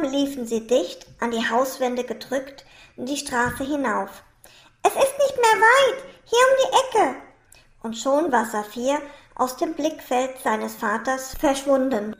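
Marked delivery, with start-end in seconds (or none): 4.8-7.2 put-on high, loud child's voice; excited call from a child; eager child calling. A narrator's reading. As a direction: urge papa onward in a raised, high voice. Child urging papa onward.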